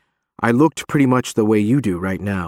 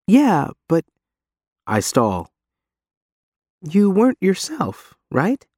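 high, hesitant reassurance; stall, then gently reassure; Reeve's voice; 9 semitones higher, slower than usual. A narrator's reading.

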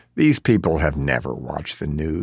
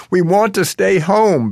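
high, loud desperate plea; loud begging; 13 semitones higher, 6 dB louder than usual. A narrator's reading. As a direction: beg them at the top of his voice. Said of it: "begs the father".

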